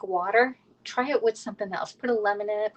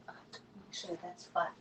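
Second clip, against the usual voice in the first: whisper; 15 dB quieter, slower than usual. Real, not acted. Aside while cooking.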